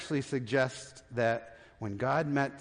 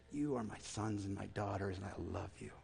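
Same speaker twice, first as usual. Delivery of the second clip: tender, loving God; 5 semitones lower, 10 dB quieter than usual. Acted. God's loving whisper to children.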